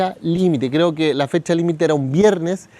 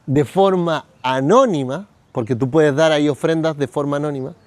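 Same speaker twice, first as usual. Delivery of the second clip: ordinary talk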